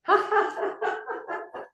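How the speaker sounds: high running laugh; high, quick-fire giggle; exercise laughter. Shown as a demonstration. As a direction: laugh as you run. Laughing while running for airport.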